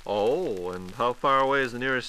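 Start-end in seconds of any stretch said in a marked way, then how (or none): none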